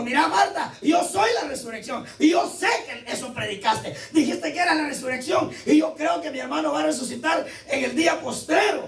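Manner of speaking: half-shouted, hammed-up reproach; Martha protesting. Martha voiced reproaching Jesus.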